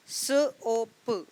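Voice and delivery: एस ओ पी spelled letter by letter; name each letter of the word slowly; spelling out letters. Shown as a demonstration. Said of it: "चार letters हैं लेकिन तीन sounds हैं".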